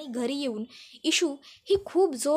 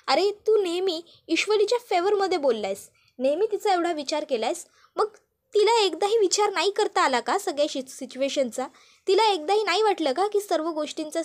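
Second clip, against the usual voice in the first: upset outburst; 4 semitones higher than usual. Acted. Younger brother protests to Arnav, agitated and indignant. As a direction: vent the frustration at her.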